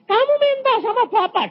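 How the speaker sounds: high, insistent mock yell; retold shout. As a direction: cry it out high, as in a retold exchange. Repeats his shouted reply to spouse. (seruan tinggi dan lantang, menirukan)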